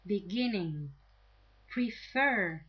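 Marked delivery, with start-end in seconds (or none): 1.7-2.6 Prefer with FER punched; stress on second syllable. Shown as a demonstration. Teacher giving a two-syllable example, Prefer stressed on FER. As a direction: hit the last syllable of Prefer. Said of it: "have the stress on the second syllable".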